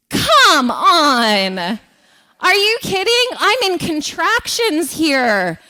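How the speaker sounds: high, loud, slow incredulous protest; mock outrage, drawn out; exasperated disbelief. Disbelief at the doctor mid-labor.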